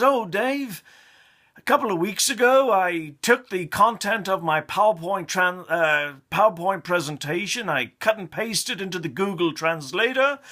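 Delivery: boastful colleague. Retold or imitated. Colleague boasting to Dave about translation, hammed-up high voice. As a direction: crow over a clever trick.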